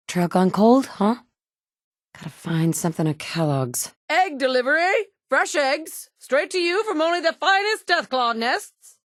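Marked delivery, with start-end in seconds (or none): 4.1-9.0 high, far-carrying vendor's cry; street hawker call. Acted. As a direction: shout your eggs like a market seller. Hawker calling out egg delivery.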